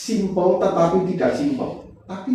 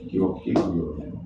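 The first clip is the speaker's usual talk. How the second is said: unvoiced, low whisper of disbelief; hushed gila gila gila under the breath; whispered thought; 12 semitones lower, 5 dB quieter than usual. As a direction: breathe it to yourself.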